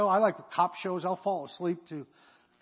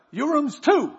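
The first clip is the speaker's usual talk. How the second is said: high, loud clerk voice, much higher than his own; motel clerk voice; 11 semitones higher, 10 dB louder, slower than usual. Retold or imitated. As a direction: play the bored desk clerk, pitched way up. Clerk Hakeem voiced in story.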